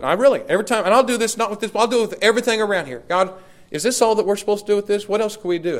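high, loud, fast pleading prayer; preaching at full force. Acted. Pastor crying out to God before his church, fervent preaching. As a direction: pour the questions out to God, preacher-style.

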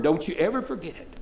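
usual speaking voice

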